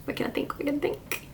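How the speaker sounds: very soft, half-whispered muttering; muttering to oneself. Real, not acted. Vlogger searching her memory mid-video, muttering to herself. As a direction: think aloud under your breath. Trying to recall her product.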